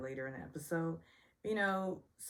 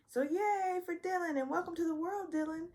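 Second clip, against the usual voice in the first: excited cheer; 13 semitones higher, 3 dB louder than usual. Real, not acted. Cheering the new baby.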